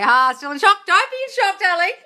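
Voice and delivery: high, loud theatrical gushing; theatrical exclaiming. Acted. Streamer answers a shocked viewer, theatrical exclaiming.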